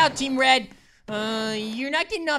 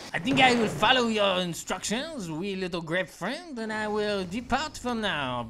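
put-on bow out grandly in a French accent; 2 semitones lower than usual. French grape character leaving politely, pompous French accent. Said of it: "You sound like you need a lozenge".

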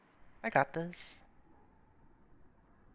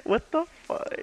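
surprised; slower than usual. Real, not acted. Confused by intruding neighbor.

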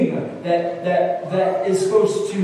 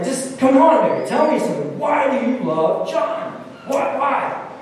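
pressing professor; 7 semitones higher than usual. Retold or imitated. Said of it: "she's like".

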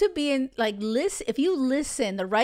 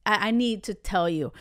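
usual speaking voice

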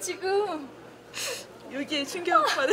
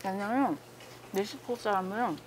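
humming while chewing food; muffled by a mouthful; mouth full, chewing; 6 semitones lower, 7 dB quieter than usual. Real, not acted. Seen 1: fork to mouth, chewing full mouthful through the labelled hums.

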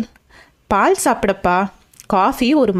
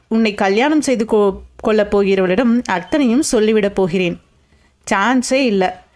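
usual speaking voice

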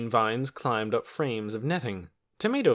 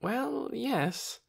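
weary; 13 semitones higher than usual. A narrator's reading. The doctor tires of the children's squabble, weary. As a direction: concede it tiredly. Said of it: "in a weary voice".

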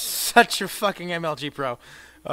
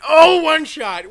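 excited exclaim; 10 semitones higher, 12 dB louder, slower than usual. Real, not acted. Excited one-shot.

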